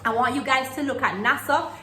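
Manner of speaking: usual speaking voice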